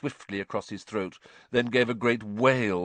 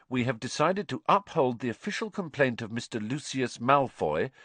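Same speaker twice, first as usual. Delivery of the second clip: higher, formal reading of a letter; reading aloud; 5 semitones higher than usual. A narrator's reading. Harry reads the committee's letter on, formal reading aloud. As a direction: read the official ruling out clearly, pitched up. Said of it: "inviting Harry to read on".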